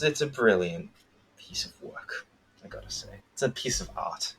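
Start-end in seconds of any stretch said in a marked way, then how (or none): 1.4-4.3 hushed reverent whisper about the show. Acted. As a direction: breathe the praise like a secret.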